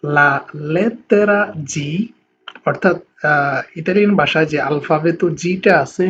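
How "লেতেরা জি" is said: Italian letter name, slow and careful. Shown as a demonstration. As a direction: spell out লেতেরা জি slowly, every syllable drawn. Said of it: "আজকের এই ক্লাসে আমরা শিখব".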